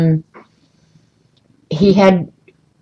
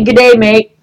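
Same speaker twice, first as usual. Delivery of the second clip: imitating Aussie greeting; 4 semitones higher, 4 dB louder than usual. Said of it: "I did hear people say".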